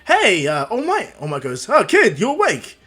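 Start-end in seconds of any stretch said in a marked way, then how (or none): 0.1-1.1 loud, higher-pitched hail; raised voice climbing on the name; interrupting boy. Acted. 1.7-2.8 high, loud flustered surprise; high-pitched, raised-voice stammering shock; startled hero. Acted. Hero caught off guard.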